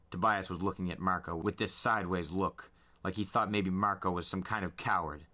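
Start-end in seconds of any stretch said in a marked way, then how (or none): none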